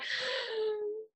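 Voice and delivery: sharp, hushed gasp of fright; mock horrified gasp. Hearing the spider is big, a mock horrified gasp. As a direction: gasp in pretend horror.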